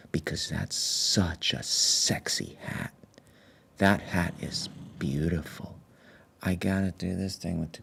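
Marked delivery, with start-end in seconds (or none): 0.1-5.8 mock seductive whisper. ASMR sexy hat compliment.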